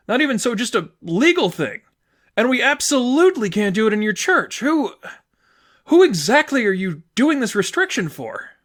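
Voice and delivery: put-on upset girlfriend arguing about marriage rules. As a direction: press each point, voice rising. Sadie's voice, arguing.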